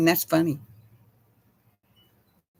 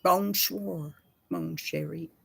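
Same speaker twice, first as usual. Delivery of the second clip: softer, lighter French greeting; fading French murmur; 2 semitones lower, 7 dB quieter, slower than usual. Shown as a demonstration. After a Texan version, the French greeting said more gently. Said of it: "That's it".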